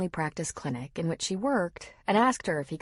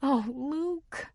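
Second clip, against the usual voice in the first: soft tearful; 8 semitones higher than usual. A narrator's reading. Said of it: "she said softly".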